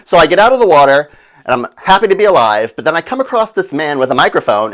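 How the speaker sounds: ordinary talk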